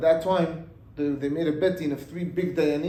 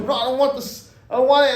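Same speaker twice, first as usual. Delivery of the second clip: angry refusal; 7 semitones higher, 8 dB louder, faster than usual. Acted. Widow refusing the letter.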